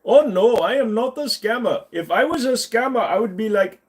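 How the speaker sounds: put-on indignant Indian accent, high and loud; Indian accent scammer. Streamer mocking a phone scammer, high loud Indian accent.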